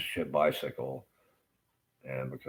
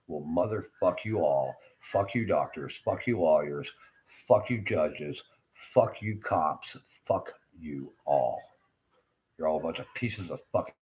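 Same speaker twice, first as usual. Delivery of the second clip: emphatic cursing, slowing down at the end; real angry tirade; 3 dB louder than usual. Real, not acted. Patient cursing doctors, lawyers, judges and cops, real anger. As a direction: curse each group in turn, punching every phrase.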